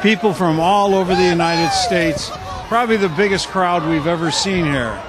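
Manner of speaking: announcer calling over a noisy crowd; live play-by-play. Real, not acted. Live commentary on the plunge.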